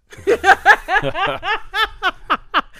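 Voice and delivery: long, high, loud cackling laugh; big laugh. Real, not acted. Speaker joking about needing upgrades with age, loud helpless laugh. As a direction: burst into loud, sustained laughter.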